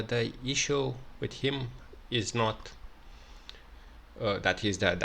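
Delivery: normal talking voice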